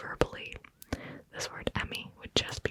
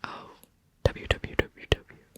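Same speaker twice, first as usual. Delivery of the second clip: mock laughing W; 6 dB louder than usual. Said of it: "laughing like".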